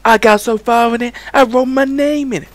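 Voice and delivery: squeaky-high silly bragging; comic squeal. Acted. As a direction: push it way up high for the gag. High comic put-on voice in skit.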